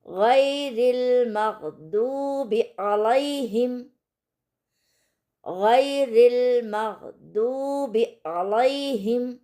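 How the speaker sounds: slow, drawn-out Quranic recitation of गैरिल मग़दूबि अलैहिम with a thick ghain in गैरिल; tajweed recitation demo. Shown as a demonstration. Teacher recites गैरिल मग़दूबि अलैहिम for students, slow. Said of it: "यहाँ पर हम गैरिल मग़दूबि अलैहिम की practice करेंगे".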